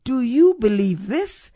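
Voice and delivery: "Do you believe THIS?", rising on the last word; stress on THIS. Shown as a demonstration. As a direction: land the question on "this". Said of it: "I've added the emphasis".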